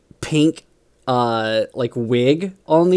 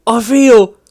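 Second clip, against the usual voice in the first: much higher, loud wondering orc; a brute discovering his cute side; dreamy orc; 9 semitones higher, 8 dB louder than usual. Retold or imitated. Transformed orc confessing new feelings to the boss, dreamy. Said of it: "the orc is just like".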